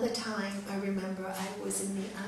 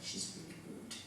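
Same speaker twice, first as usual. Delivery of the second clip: whispered aside; 12 dB quieter than usual. Retold or imitated. Husband murmuring during rude student.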